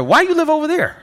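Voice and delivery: put-on high, challenging kid voice; mock a schoolkid who can't believe it; imitating a kid. Schoolkid challenging the new boy's neighbourhood, high and pointed. Kids question him at school.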